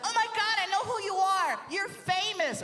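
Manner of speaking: put-on high excited girly squeal; starstruck fan girl. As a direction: gush like a fan spotting a celebrity. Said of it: "She's like".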